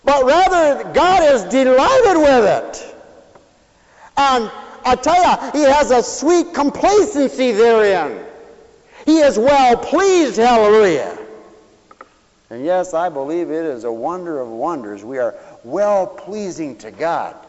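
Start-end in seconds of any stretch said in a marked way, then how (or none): 0.0-11.2 loud, high, slow preaching cry; fervent preaching. Acted. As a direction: preach it out to the whole church. Preacher rising to full force.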